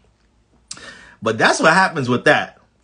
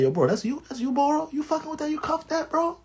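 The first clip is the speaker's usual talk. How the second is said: much higher, hushed disbelief; mock incredulous friend; 13 semitones higher, 7 dB quieter than usual. Friends at the cookout asking if he cuffed her, high hushed disbelief. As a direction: play the stunned homie, voice climbing high. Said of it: "Everybody coming up to me like".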